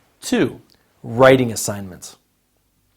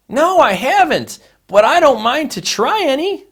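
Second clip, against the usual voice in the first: higher pitched reply voice; acted high answer; 12 semitones higher than usual. Teacher voices the second speaker answering the question.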